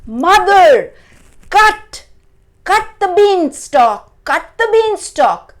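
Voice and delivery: high, loud urgent shouts; crying out. A narrator's reading. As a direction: shout the order in desperate haste. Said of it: "Jack cried out".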